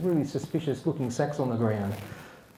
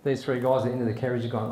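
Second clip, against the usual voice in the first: usual speaking voice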